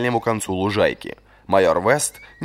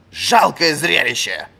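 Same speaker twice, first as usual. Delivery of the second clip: slow, louder cry of disgust; scornful outcry; 6 semitones higher, 4 dB louder, slower than usual. A narrator's reading. Old master scorning the fallen captain. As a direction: spit out the scorn. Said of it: "вскричал старик".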